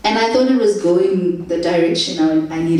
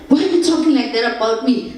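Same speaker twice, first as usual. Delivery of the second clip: indignant protest; 6 semitones higher, faster than usual. Retold or imitated. Protesting being called Mzungu.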